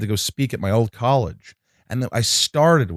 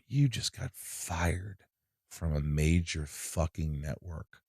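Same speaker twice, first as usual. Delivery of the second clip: stunned self-talk; 4 semitones lower, 11 dB quieter, slower than usual. Retold or imitated. Talking to himself after being fired.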